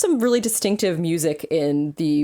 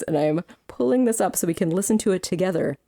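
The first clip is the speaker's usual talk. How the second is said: ordinary delivery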